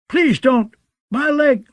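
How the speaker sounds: high, pained begging; whimpering. A narrator's reading. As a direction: beg for mercy, hurting. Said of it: "Dick whimpered".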